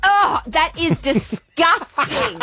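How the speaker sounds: high, slow, drawn-out mock disgust; a beach girl recoiling at a name. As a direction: stretch out the horror like a snob. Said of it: "And they go".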